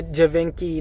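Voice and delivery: Givenchy said as juh-VINK-ee; wrong pronunciation. Shown as a demonstration. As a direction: mistaken Givenchy with a hard K.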